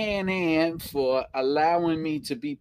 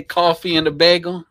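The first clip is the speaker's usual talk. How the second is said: thick New York coffee and bagel, called out loud; New York accent; 8 dB louder than usual. Shown as a demonstration. Reading a viewer's request, thick New York accent. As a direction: say it like a Brooklyn deli regular. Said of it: "Say coffee and a bagel repeatedly".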